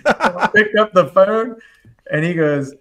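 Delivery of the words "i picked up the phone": slow, loud, laughed-out words; laughing. Real, not acted. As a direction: talk through real laughter, pitch raised. Laughing while telling the story.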